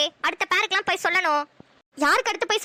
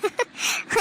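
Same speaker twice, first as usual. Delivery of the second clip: irrepressible laugh. Acted. Crying bride hears friends' wild accusations, helpless laugh. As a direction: laugh that cannot be held in. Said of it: "சிரிப்பே அடக்க முடியல".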